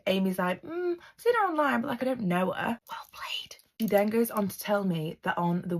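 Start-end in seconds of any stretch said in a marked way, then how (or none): 0.6-2.8 high, airy noncommittal voice; coy evasion. Retold or imitated. Acting Amy's coy evasive answer. 2.9-3.6 hushed, admiring whisper; whispered aside. Real, not acted. Whispered aside repeating the praise.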